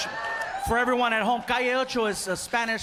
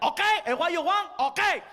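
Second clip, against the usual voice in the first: annoyed waiter; 7 semitones higher than usual. Retold or imitated. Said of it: "So the guy's like".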